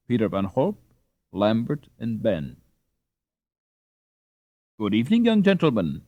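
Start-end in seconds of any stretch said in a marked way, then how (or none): 4.8-6.0 higher and fast, bubbling with pride; happy and proud. A narrator's reading. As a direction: greet the boys all aglow. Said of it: "so happy and proud".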